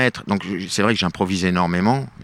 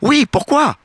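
much higher, louder put-on voice, a sly question back; mock soldier's retort; 5 dB louder than usual. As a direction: answer the general's question with a question.

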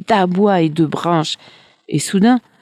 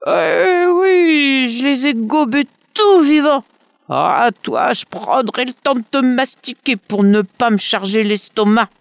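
higher, lightly voiced monster mocking; gloating dragon; 8 semitones higher than usual. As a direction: relish the threat like a beast.

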